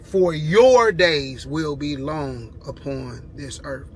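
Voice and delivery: slow, weighty scripture reading; For your days will be long drawn out and stressed; slow emphasis. Shown as a demonstration. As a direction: lean on each word so they catch it. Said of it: "Now catch this".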